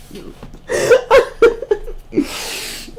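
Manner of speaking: high breathless giggling; a helpless silent giggle; high giggle fit. Real, not acted. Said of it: "makes me laugh".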